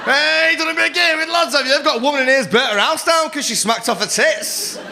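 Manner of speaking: acted exasperated shouty scolding; berating counsellor. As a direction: rant at them to get back out there. Counsellor's acted rant continues.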